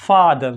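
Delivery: फादर with a long, open, unrounded AH. Shown as a demonstration. Teacher giving a model word for American O, long open AH in फादर. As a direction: open the mouth on the first vowel of फादर. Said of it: "इस ए के sound को pronounce".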